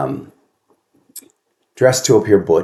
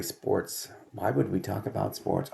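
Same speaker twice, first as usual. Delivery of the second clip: a soft, breathy mutter to herself; whispered aside; 12 dB quieter than usual. Real, not acted. Host grumbling to herself about the topic of sports, whispered. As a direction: whisper it grudgingly under your breath.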